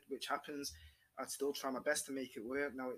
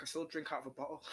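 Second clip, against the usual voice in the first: ordinary talk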